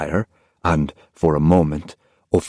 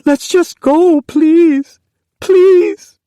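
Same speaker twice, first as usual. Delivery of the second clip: high, rising, tearful pleas; girl pleading with mother, growing desperate; desperate begging; 7 dB louder, much slower than usual. Acted. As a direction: beg harder each time. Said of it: "she begged".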